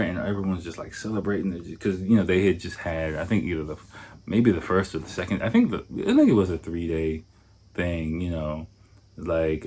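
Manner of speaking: normal talking voice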